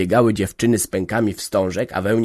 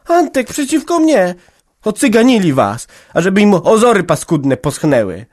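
put-on very high, loud young woman cursing; indignant outburst; 9 semitones higher, 7 dB louder than usual. A narrator's reading. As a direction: spit out the curse, outraged. Daughter angrily disbelieving. (oburzona Jagna przeklina)

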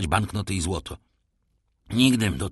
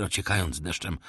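ordinary delivery

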